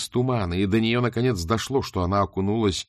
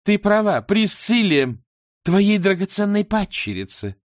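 triumphant gloat; 11 semitones higher, 6 dB louder, slower than usual. Acted.

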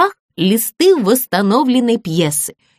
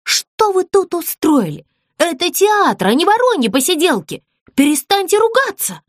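scolding; 8 semitones higher than usual. Acted. Ann scolding quarrelling dolls.

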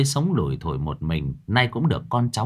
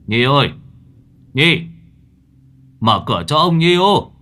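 loud, higher calls through the door; calling out; 7 semitones higher, 6 dB louder than usual. A narrator's reading. Grandfather outside shouts for Nhi to open the door.

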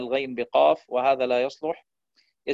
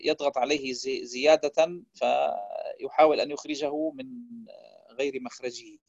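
ordinary talk

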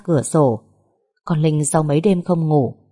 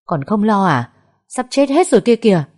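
scolding; 5 semitones higher, 5 dB louder than usual. A narrator's reading. Man scolding Vân.

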